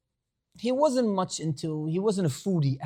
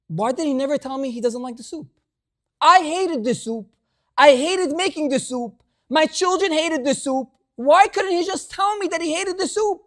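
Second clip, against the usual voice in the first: upset widow; 9 semitones higher, 4 dB louder than usual. Retold or imitated. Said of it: "she's crying".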